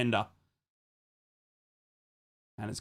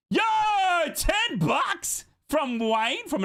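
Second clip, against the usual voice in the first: hyped donation callout; 5 dB louder, much slower than usual. Real, not acted. Announcing a superchat donation.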